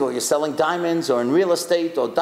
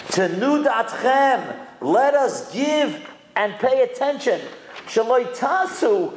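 slow, raised declamation; solemn quotation; 6 semitones higher, slower than usual. Retold or imitated. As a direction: recite it slowly, making each word land. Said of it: "listen to what he says".

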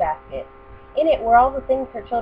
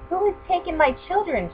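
crying out; 9 semitones higher than usual. Acted. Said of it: "she cried".

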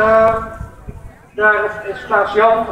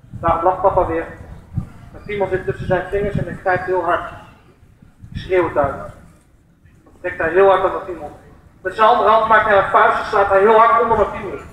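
ordinary talk

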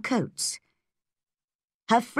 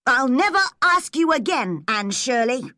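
higher, louder stung outburst; angry retort; 6 semitones higher, 4 dB louder than usual. Acted. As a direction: throw the words back at her. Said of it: "jumped angrily".

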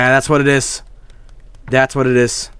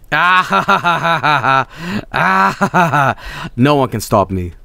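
villain laugh; 2 semitones higher than usual. Pretend. Gloating evil laugh.

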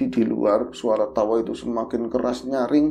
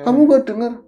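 high, louder urgent question; boy pressing the drowsy servant; urgent high call; 11 semitones higher, 9 dB louder than usual. Retold or imitated.

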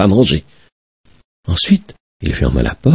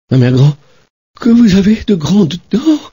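put-on high, amazed little girl; wondering girl; 13 semitones higher than usual. Little girl in bed marvelling at grandmother, high. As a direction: marvel at her arms.

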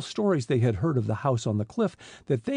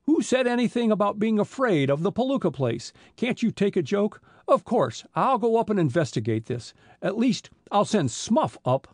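higher, mildly nervous backpedalling; 6 semitones higher than usual. A narrator's reading. Police chief backing down to the boys, nervously. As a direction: protest in a raised pitch, slightly rattled. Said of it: "said the chief nervously".

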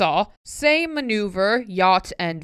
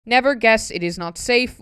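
ordinary talk

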